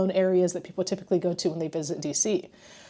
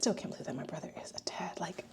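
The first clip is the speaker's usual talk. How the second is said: fast hushed whisper of disbelief; awed whisper; 13 dB quieter, faster than usual. Real, not acted. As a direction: breathe it out, still amazed. Emotional about brother's baby.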